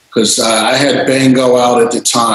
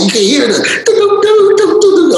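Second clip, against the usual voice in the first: imitating organ keys.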